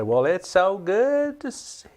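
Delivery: high, slow country drawl with a big folksy welcome; Gomer Pyle voice. Retold or imitated. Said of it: "he sounds like Gomer Pyle".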